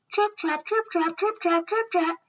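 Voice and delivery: high, quick-beat trip-trap; hurrying hoofsteps. A narrator's reading. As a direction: tap out hurried hooves with the voice. Middle goat's hurried hooves on bridge.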